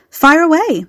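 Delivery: high, bright eager call; excited. A narrator's reading. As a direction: jump at the chance. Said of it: "flushed with excitement".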